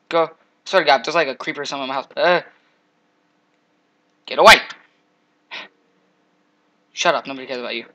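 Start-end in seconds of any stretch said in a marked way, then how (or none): 4.3-4.6 loud shout of get away; panicked shout. Real, not acted. Gamer yells at the creeper, panicked shout. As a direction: yell it at the monster. Fleeing a mob.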